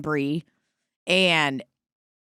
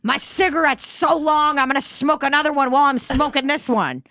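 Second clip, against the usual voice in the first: high, brash holler for Roger; speaker's own movie character; 7 semitones higher than usual. Acted. Imagining herself in an old movie, hammed-up brash yelling. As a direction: call out coarsely, played for laughs. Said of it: "you put me in a movie like that".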